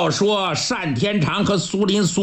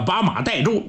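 plain reading voice

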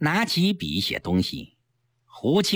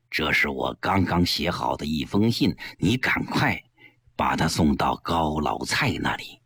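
low-pitched, measured order; instructing superior; 10 semitones lower than usual. Acted. Boss sends secretary with a letter, composed instruction. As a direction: give the errand in a deep, composed voice.

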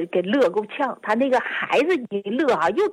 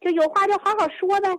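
higher, coaxing grandpa voice, last line quicker; grandpa soothing child; 6 semitones higher, faster than usual. Retold or imitated. Grandpa hugs and coaxes grandson, higher soothing voice. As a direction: comfort the crying grandson. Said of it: "完就抱这个孩子就哄啊".

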